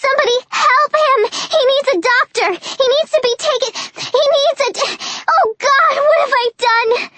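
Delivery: high, fast, frantic cries breaking off; panicked screaming. Acted. Panicked plea over fallen soldier.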